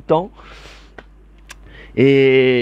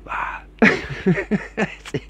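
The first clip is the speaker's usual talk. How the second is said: usual speaking voice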